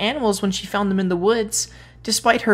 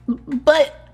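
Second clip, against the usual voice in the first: higher, louder choked start; 7 semitones higher, 5 dB louder than usual. Acted. Boy chokes on tears before the tree. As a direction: catch in the throat. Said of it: "he choked".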